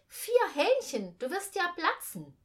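calling out. A narrator's reading. The boy exclaims at four chickens, calling out. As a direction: cry out in disbelief. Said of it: "rief ich".

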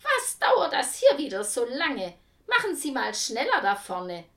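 loud, high nagging complaint; scolding. A narrator's reading.

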